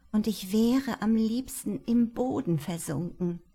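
ordinary delivery

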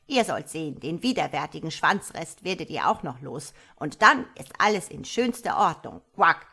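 put-on a fond mother frog trying to calm her kids; old frog mother pleading. A narrator's reading. Old mother frog coaxing her unruly children, loud and fond. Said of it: "sagte der alte Frosch".

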